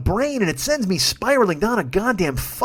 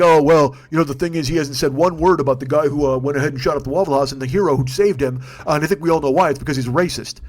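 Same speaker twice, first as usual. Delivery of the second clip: lower, put-on smug pundit voice; mock smug critic; 6 semitones lower than usual. Mocking online critics of Trump in a lower smug voice. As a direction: sneer it like a know-it-all on Twitter.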